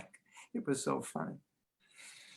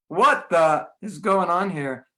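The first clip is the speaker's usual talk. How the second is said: slow, loud and higher, fed up; exasperated outburst; 4 semitones higher, 6 dB louder, slower than usual. Retold or imitated. At devotees blocking the stairway, an exasperated outburst. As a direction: let the irritation out at the blockers. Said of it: "you just wanna say".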